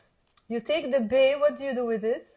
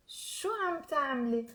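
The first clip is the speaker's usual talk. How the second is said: connected, softer question demo; fluent teaching example; said fast; 2 semitones higher, 7 dB quieter than usual. Shown as a demonstration. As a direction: model the question in one run. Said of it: "I've said it fast".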